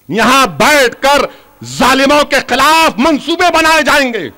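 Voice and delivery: high, driving refrain from the podium; rally them with each repeated phrase; impassioned crowd-stirring speech; rousing political oratory. Real, not acted. Rallies the crowd.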